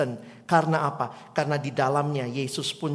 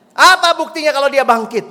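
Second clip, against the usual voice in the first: loud, high, scornful question; old man demanding proof, shouting; angry demand; 11 semitones higher, 15 dB louder than usual. Acted. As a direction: throw the challenge in her face. Old man's challenge repeated.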